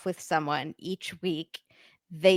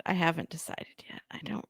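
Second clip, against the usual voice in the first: whispering; 2 semitones lower, 8 dB quieter, faster than usual. Real, not acted. Confiding aside to co-host.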